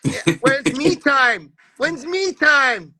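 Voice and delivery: sneering at a quitter's whine, slow and high; mock whiny complaint.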